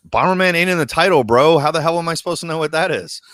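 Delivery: exasperated retort. Retold or imitated. Retelling his comeback to a buddy, exasperated.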